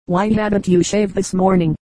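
ordinary delivery, nothing marked

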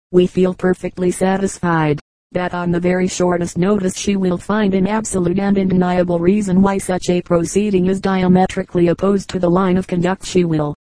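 ordinary delivery, nothing marked

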